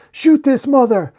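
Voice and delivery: high, loud frantic command; acted high-pitched shout; screamed order. A narrator's reading. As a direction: scream it to your men. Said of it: "we see the masked cop scream".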